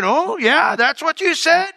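high, sneering "oh yeah"; bitter sarcasm. Retold or imitated. David brooding sarcastically over Nabal's words. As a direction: chew on the insult sarcastically.

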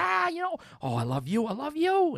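high, squealing Boston fan voice; imitating a Boston accent. Host mimicking excited Boston fans in a Boston accent. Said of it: "the great Boston accent".

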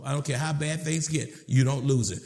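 ordinary talk, nothing marked

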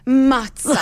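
"Matza" punched high on the first syllable; emphatic, relished "Matza"; passionate Italian pronunciation. Shown as a demonstration. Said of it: "Say it with passion".

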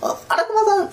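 a strained falsetto try at the lady; sweet woman's voice. Retold or imitated. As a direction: aim for a voice like a golden bell. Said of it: "こ、こんな声じゃねえな".

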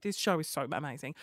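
usual speaking voice

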